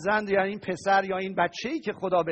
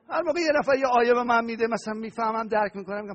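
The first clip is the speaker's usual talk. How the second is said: ordinary talk, nothing marked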